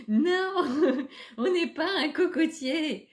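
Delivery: high, loud no through laughter; laughing protest. Real, not acted. Laughing at own coconut tree comparison, laughing protest. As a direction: laugh out an emphatic no.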